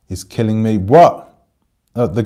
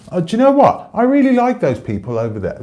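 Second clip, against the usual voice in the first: mock voice; 12 semitones higher than usual. Mocking outsiders' shallow love.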